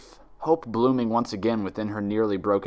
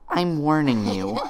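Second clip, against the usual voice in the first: strained steady warning; 5 semitones higher, 3 dB louder, slower than usual. Acted. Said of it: "fighting to keep her voice steady".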